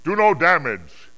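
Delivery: loud, high warning; calling aloud. Acted. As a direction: call out the order to distant angels. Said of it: "he called aloud".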